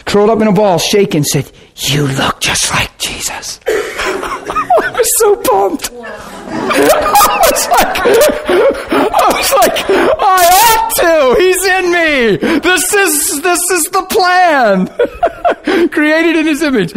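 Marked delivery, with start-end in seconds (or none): 1.8-3.6 eerie possessed whisper. Retold or imitated. Demon-possessed girl speaks. 3.6-16.9 high-pitched laughter through the words; can barely talk for laughing; laughing joyfully. Real, not acted. Preacher laughing while telling the congregation his story.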